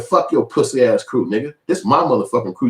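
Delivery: ordinary delivery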